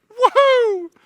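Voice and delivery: high, loud whoop of relief; joyful whoop. Retold or imitated. Dad whooping at hearing baby's heartbeat, joyous high whoop. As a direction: let out a huge happy woohoo.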